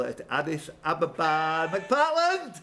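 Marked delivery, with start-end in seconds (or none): 1.7-2.6 excited call. Real, not acted. Host excitedly calls the winner.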